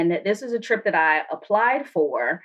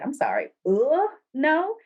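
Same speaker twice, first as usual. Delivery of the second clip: rising, higher "ugh, no?" in disbelief; mock disbelief; 8 semitones higher than usual. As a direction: ask it like you can't believe him. Retelling disbelief.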